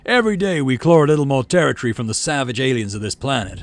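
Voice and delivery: rallying governor speech. Acted. Governor speech to colony crowd.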